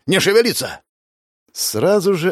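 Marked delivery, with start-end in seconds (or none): 0.0-0.8 guard's raised order; shouted command. Acted. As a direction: warn them not to move. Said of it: "услышал я голос сзади".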